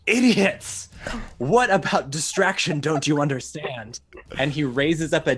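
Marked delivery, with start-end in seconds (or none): 0.1-4.0 loud, high outburst on the first word, then easing; exasperated cultist. Acted. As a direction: scold your underlings as a cult villain. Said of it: "and says".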